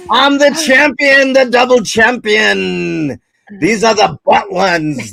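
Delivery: loud, high, badly faked Irish accent; hammed-up champion's boast in a botched Irish brogue; Conor McGregor. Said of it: "It's supposed to be Conor McGregor".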